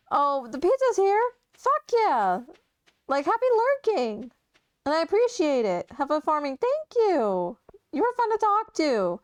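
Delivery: high, loud, delighted; excited. Real, not acted. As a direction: burst out with joy. Excited reply to chat.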